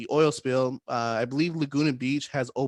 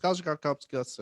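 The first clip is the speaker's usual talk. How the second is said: tripping over a tongue twister at speed; garbled, stumbling speed attempt; fast tongue twister. Shown as a demonstration. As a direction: race it until the words tangle. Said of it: "Try saying that five times fast".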